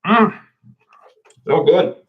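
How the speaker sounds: talking while chewing; mouth full. Real, not acted. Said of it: "I still have a little bit of my chocolate ball".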